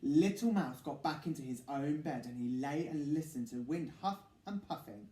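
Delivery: ordinary delivery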